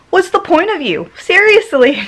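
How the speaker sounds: exasperated jab at stubborn veins; mock scolding. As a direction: tell off your useless veins in mock disbelief. Scolding her own veins.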